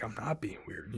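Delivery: very soft, small imitated protest; meek retort. Yelled-at man protesting he isn't weird, meek and very quiet. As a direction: answer under the yell, barely audible.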